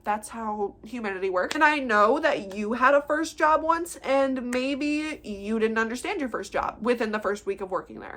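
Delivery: ordinary talk, nothing marked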